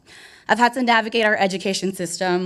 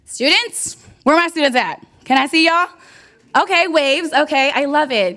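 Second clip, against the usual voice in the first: calling out; 6 semitones higher, 5 dB louder than usual. Real, not acted. Calling out to students.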